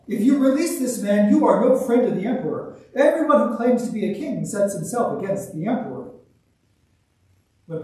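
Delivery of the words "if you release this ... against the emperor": raised, loud accusing cry; loud, high-pitched protest. A narrator's reading. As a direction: cry it out as a public warning. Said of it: "the Judeans cried out".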